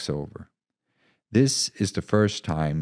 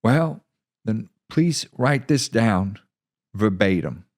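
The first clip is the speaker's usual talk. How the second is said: measured commander; deliberate commander; 6 semitones higher, 6 dB louder than usual. Acted. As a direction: make sure he gets every word. Commander dictates.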